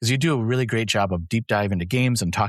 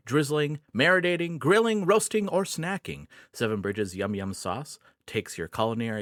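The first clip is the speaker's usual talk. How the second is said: chipper 1950s ad; 7 dB quieter than usual. Acted. Sponsor ad read.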